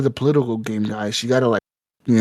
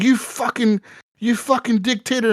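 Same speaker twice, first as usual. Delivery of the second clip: higher-pitched mock outrage; hammed-up furious politician, pitched up high; mock angry congressman; 8 semitones higher than usual. Congress yelling at the president, mock angry and higher. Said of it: "Congress was pissed 'cause they're like".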